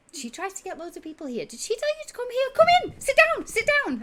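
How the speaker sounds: very high and loud, hurried invitations; eager tabaxi host. Acted. Tabaxi pulling the party inside, very high and excited. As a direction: herd them to seats like a bubbly host.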